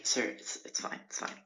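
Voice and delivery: soft whisper, brushing it off; lightly mimicked whispered calm; hushed reassurance. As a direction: whisper it kindly, no need. Clerk waves him through.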